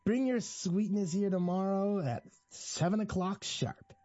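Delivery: high, slow, affected character voice; syrupy high-pitched eccentric giving an order; patronizing, delighted Jack. A narrator's reading. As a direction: drag it out high with gleeful condescension. Jack's patronizing voice continues.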